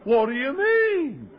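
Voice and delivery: very high, slow, drawn-out protest; indignant innocence. Retold or imitated. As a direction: act out a clueless, affronted question, stretched right up high. Retold clueless indignant reply.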